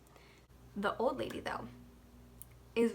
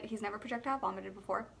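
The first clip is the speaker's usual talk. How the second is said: ordinary delivery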